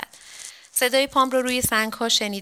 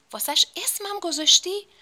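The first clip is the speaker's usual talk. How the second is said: high, louder surprise at the name; stunned he even named her; astonished question; 10 semitones higher, 4 dB louder than usual. Acted. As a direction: ask it amazed, pitch jumping up. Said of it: "حبیب آقا با تعجب گفت".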